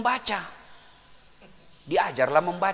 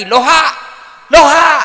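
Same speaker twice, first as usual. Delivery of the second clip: loud high yell of loha; comic mock shout; shouting backwards hello; 9 semitones higher, 13 dB louder than usual. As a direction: bellow it, sure it's right now. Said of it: "Dia bilang lagi".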